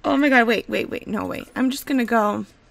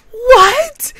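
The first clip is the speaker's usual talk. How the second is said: very high, loud shriek of disbelief; astonished squeal; 13 semitones higher, 11 dB louder than usual. Real, not acted. Gamer amazed by toddler teeth options, astonished squeal.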